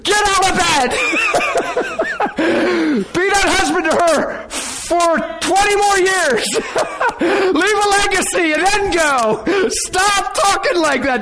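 high-pitched shouting broken by laughs; hammed-up, wailing exasperation; frantic inner pleading. Preacher mentally begging husband to stay alive, frantic pleading. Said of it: "That's how I'm thinking".

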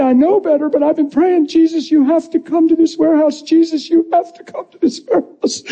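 high-pitched, crying prayer; imitated tearful pleading. Head of HR praying for Jesus to come, tearful. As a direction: plead through tears, imitating her. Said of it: "she starts bawling".

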